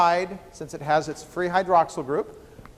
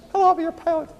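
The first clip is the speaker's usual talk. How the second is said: pilot greeting in a squeaky, much higher voice; 11 semitones higher, much faster than usual. Shown as a demonstration. Lecturer shows the joke pilot in the high voice he skipped. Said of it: "Deans don't have high voices like that".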